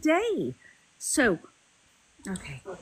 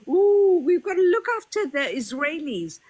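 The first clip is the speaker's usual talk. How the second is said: mocking quote; 5 semitones higher than usual. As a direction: parody him as a fussy do-gooder, fast. Mocking an opponent's opinion.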